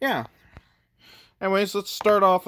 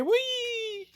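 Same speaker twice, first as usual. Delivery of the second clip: playful whee. Pretend. Toy mech flying off.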